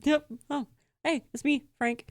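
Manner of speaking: a hint of a laid-back Korg; Taika Waititi voice. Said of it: "imagine that in Taika Waititi's voice".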